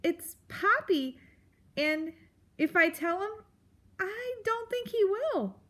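high little mouse, hesitant; meekly insist, pitch climbing; Poppy. Acted. Mouse corrects the grump and doubts the fox, meek.

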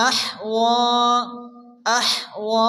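أحوى with the حا breathed and وى held; breathy ha, long wa. Shown as a demonstration. Teacher showing students breath and length in one word. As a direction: breathe out the ح and stretch the end of أحوى. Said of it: "মুখ দিয়ে বাতাস বের করে".

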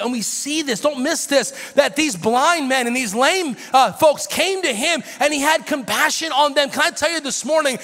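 loud, high, sung-out preaching; whooping preacher cadence; full-force preaching. Acted. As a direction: preach it out with rising fervor.

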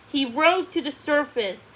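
ordinary delivery, nothing marked

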